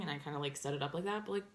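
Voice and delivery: ordinary talk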